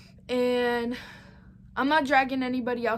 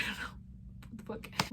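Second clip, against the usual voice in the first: whispering; 2 semitones lower, 14 dB quieter, faster than usual. Real, not acted. Giggly aside, voice dropping.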